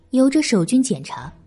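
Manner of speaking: ordinary delivery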